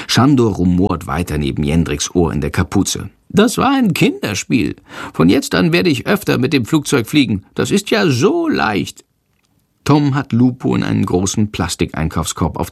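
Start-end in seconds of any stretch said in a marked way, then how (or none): 3.3-9.0 high, cocky bat voice; high and smug, a squeaky bat; boastful little bat. Acted. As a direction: brag like a tiny bat who fooled everyone. Schandor boasting again.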